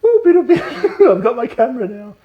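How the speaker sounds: very high giddy whoop with laughter; gleeful whoop. Retold or imitated. Ryan Gosling running off with the camera, giddy squeal. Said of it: "Ryan Gosling's just like".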